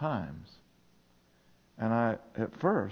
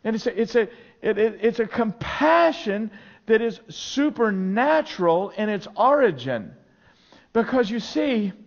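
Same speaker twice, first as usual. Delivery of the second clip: loud, high, driving sermon delivery; full-force preaching; 11 dB louder than usual. Acted. Pastor to congregation, preaching at full force.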